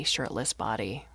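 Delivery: plain reading voice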